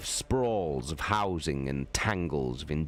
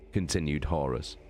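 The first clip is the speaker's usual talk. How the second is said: ordinary delivery, nothing marked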